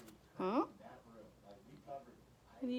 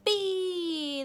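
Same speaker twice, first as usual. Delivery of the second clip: high, drawn-out mock yell; imitated children's shout, stretched; kids yelling answer; 9 semitones higher than usual. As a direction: call it out like a kindergarten class. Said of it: "they all yell".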